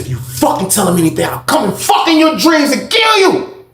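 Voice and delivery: building to a high shouted threat; furious threat. Retold or imitated. Shane threatens the cellmate.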